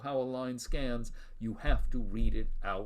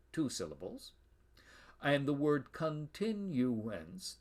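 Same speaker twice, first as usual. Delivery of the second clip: ordinary talk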